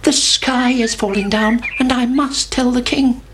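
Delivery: high little chick voice; Chicken Licken. A narrator's reading. Chick runs to tell the king, high and urgent. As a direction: pipe it up like an alarmed chick. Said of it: "said Chicken Licken".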